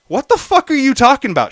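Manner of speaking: retold indignant question; indignant retort. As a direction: act out an incredulous comeback. Retold quarrel, his indignant reply.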